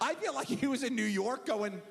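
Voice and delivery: ordinary talk, nothing marked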